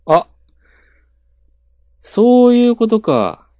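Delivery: sudden high, slow realization; realizing surprise. Real, not acted. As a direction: it dawns on you, loud.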